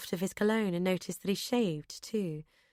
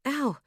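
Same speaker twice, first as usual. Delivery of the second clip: pained cry; 7 semitones higher than usual. Acted. Ankle gives out, leaning.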